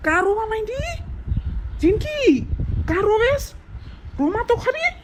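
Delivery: very high, cooing little voice; coaxing baby-talk. Acted. Husband soothes his crying wife, coaxing high-pitched voice. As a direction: squeak it tenderly, pitched far up.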